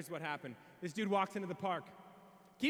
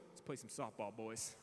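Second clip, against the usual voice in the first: much lower, softer drawled greeting; cool newcomer; 9 semitones lower, 10 dB quieter than usual. Retold or imitated. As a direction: play it deadpan cool. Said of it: "and goes".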